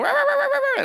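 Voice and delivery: high yappy barks; dog barking. Retold or imitated. Preacher imitating a dog guarding its yard, high barking. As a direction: bark like an angry dog at the gate. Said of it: "somebody walking by in the window".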